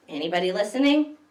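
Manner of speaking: ordinary delivery, nothing marked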